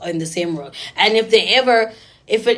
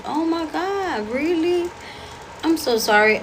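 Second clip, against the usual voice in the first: mock sympathy; 6 semitones higher, slower than usual. Fake sympathy toward crying boyfriend.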